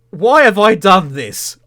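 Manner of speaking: ordinary talk, nothing marked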